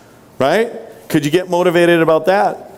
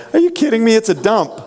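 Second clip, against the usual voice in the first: mock disbelief; 7 semitones higher, faster than usual. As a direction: scoff in high-pitched disbelief at the compliment. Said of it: "And they go".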